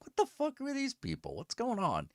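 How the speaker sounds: high but soft confused question; puzzled aside to the screen; baffled mutter. Retold or imitated. Viewer muttering at the TV, confused. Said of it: "talking back to the screen".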